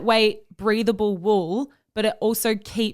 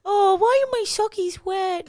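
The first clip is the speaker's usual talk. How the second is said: put-on high, babyish whine; whiny baby voice; 9 semitones higher than usual. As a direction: pout it like a toddler. Mocking whiny complaint.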